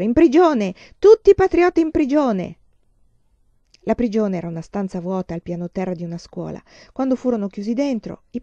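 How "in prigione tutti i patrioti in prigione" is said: loud, high cheering cry; laughing shout. Acted. Spirito Risoluto joining the marchers, loud gleeful shout. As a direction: shout it out in glee. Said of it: "ridendo e prese a gridare".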